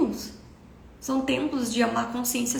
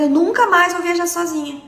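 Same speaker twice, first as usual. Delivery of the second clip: loud, higher retelling of mother's vow; mother's emphatic decree; 4 semitones higher, 8 dB louder than usual. Retold or imitated. Daughter retelling mother's decree about travel, emphatic and loud. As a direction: declare it forcefully, as mother did. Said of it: "Minha mãe fez um decreto".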